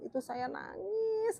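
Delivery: mild imitated weeping; tearful admission. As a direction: let the voice thin out as she admits crying. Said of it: "katanya gitu".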